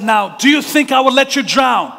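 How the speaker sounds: high, loud, insistent question; shouted reassurance. Retold or imitated. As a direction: shout it so the boy hears over his fear.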